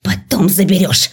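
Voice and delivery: приглушённо-злая реплика преподавательницы; процеди это с раздражением; сердитое шипение. A narrator's reading. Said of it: "прошипела она". (сердито, с лёгким шипением)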